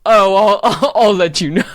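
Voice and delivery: loud, laughing drag queen sass; depressive drag queen. Retold or imitated. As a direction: laugh through a camp sneer. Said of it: "depressive drag queen voice".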